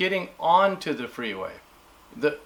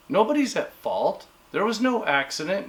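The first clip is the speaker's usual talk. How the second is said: ordinary talk, nothing marked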